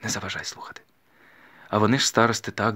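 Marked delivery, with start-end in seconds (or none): 0.0-0.8 hushed rebuke. A narrator's reading. Taras on the stove shushing his sister, hushed. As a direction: murmur it so nobody overhears. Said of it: "пошепки сказав Тарас".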